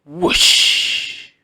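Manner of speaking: breathy, loud whoosh of a wave; rushing water whoosh. A narrator's reading. Narrator voicing the pumpkin bursting open, loud breathy whoosh. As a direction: blow it out like water bursting free.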